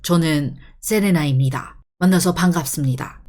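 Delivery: stiff formal self-introduction; measured, bookish Korean announcement style; announcing. Shown as a demonstration. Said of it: "it sounds like you're announcing something".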